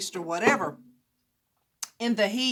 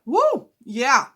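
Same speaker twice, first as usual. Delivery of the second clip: excited shout; 4 semitones higher, 8 dB louder than usual. Real, not acted. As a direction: whoop from the spicy heat. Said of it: "I don't know why I'm screaming".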